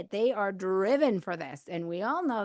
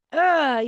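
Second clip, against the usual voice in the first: a sharp, loud ugh of annoyance; loud disgusted groan; 6 semitones higher, 10 dB louder than usual. Retold or imitated. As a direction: groan it out, fed up. Voicing her irritation mid-story.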